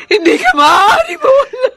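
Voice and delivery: sobbing shout. Acted. Mother begs her dying daughter, high sobbing shout. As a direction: wail it through sobs.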